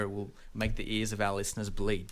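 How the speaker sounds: usual speaking voice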